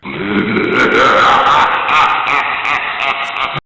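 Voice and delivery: long, theatrical spooky cackle; spooky laughter. Acted. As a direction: draw out an eerie haunted-house laugh. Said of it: "echoing with spooky laughter".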